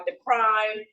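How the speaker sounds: usual speaking voice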